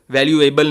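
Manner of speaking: वैल्यूएबल as "val-yu-ay-bal"; mispronounced वैल्यूएबल. Shown as a demonstration. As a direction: put an extra "ay" into वैल्यूएबल before -bal. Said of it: "नहीं पढ़ा जाता ये".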